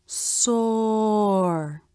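"soar" stretched very long, vowel rounded into the R; drawn-out SOAR. Shown as a demonstration. Teacher showing ah followed by R in another word, "soar" drawn out slowly. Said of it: "When the ah vowel is followed by the R consonant".

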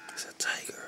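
hushed wildlife-host whisper; very soft, breath-only whisper in imitation of a TV animal tracker; Crocodile Hunter impression. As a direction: whisper as if stalking a dangerous animal. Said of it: "talking like this to talk like that guy. The crocodile hunter".

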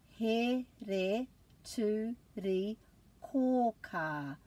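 He, re, tu, ri, kō, kā, each syllable split apart. Shown as a demonstration. Teacher breaking a long Māori word for class. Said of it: "put a break after every vowel".

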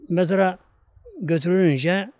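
ordinary delivery

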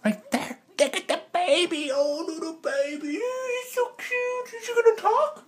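very high cooing pet-talk, slow in places; high sing-song cooing at a cat. Real, not acted. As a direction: gush over the kitty.

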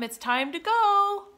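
put-on high, loud child's call. A narrator's reading. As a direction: call it out to the hidden dog. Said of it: "called Sam".

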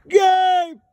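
loud, high, drawn-out shout; calling out. Real, not acted. Hiker calling a companion on the trail, calling out. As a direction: holler the name across distance.

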